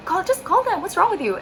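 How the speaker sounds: high-pitched exasperated nagging; pestering. Retold or imitated. Traveller pushing her sister to just call them, pestering. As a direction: needle in a high, insistent voice. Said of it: "I was making her kulit".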